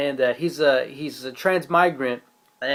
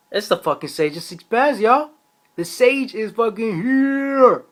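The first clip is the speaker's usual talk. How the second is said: hyped; 8 semitones higher, 4 dB louder, slower than usual. Real, not acted. Hyping the Sage's appearance.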